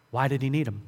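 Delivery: normal talking voice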